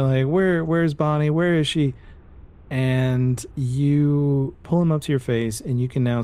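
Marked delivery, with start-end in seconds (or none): 0.3-1.9 mock yell. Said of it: "and you're yelling".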